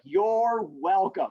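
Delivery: very slow, stretched-out reply; mock self-satisfied. Teacher accepts students' imagined thanks, playfully smug and drawn out. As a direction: savour each word as if owed thanks.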